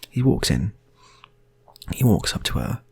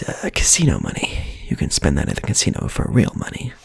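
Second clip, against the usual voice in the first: normal talking voice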